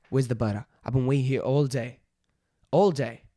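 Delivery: loud mock-impatient Aussie complaint; Australian accent. Shown as a demonstration. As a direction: call it out in broad Australian. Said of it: "let's try Australian".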